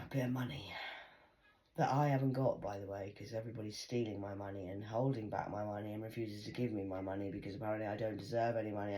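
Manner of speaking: usual speaking voice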